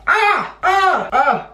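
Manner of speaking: short high-pitched "ah" cries; sudden squeals. Real, not acted. Interrupting the story during makeup, short squeals. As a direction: let out quick, high yelps.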